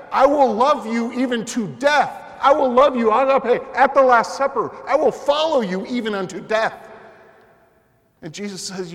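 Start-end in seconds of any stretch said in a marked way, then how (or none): 0.1-6.9 loud, high, fervent pledge; impassioned vow. Acted. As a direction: proclaim it like a bold oath of loyalty. Acting out Peter's bold vow.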